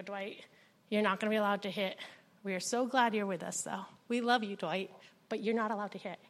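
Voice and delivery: put-on soft, steady firmness to a struggling child; calm and tender. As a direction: soothe him while holding the line. Holding an angry child calmly.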